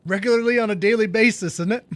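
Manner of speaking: light mock English tag question; British accent. Streamer jokes to chat in a light British accent. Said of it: "the accent".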